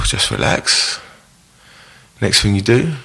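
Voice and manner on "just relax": breathy whisper, much lower and softer; whispered to self. Shown as a demonstration. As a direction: whisper it to calm yourself down. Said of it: "So you'll go".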